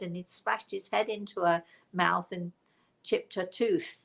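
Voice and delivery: normal talking voice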